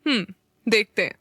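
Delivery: short, falling, assured reply; confident. A narrator's reading. Neel takes up Meera's challenge, confidently. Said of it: "नील भी confidence से बोला".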